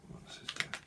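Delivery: breathy whisper, asking the cards; whispered aside. Real, not acted. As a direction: whisper the question to yourself.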